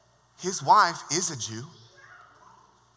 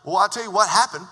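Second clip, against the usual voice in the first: ordinary talk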